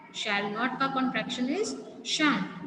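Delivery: ordinary talk, nothing marked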